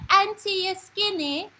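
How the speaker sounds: put-on high, slow child's voice; imitated little grandniece. Grandniece coming over to question her aunt, high mimicked child's voice. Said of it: "so she come and she said".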